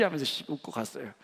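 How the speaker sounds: normal talking voice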